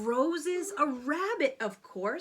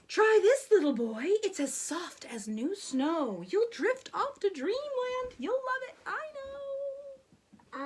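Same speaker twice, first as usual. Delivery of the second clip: coaxing; 7 semitones higher than usual. Acted. Peasant coaxes the prince.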